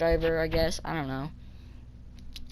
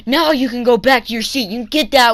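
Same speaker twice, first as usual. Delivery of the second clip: loud, higher mock telling-off; imitated scolding; 5 semitones higher, 8 dB louder than usual. Bus driver sending kid to seat, loud imitated scolding.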